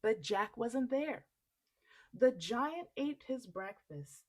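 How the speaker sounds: usual speaking voice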